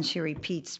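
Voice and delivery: ordinary delivery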